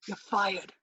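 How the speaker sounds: low, quiet firing line; stern boss. Pretend. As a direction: fire them coldly. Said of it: "Wait, ready?".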